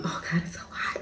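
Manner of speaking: breathy whisper about the heat; whispered to herself. Real, not acted.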